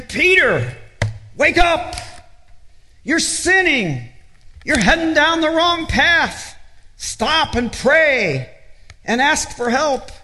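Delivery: high, pleading call to wake Peter up; urgent warning. Acted. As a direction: call out to him like an alarm, stretching stop and pray slowly.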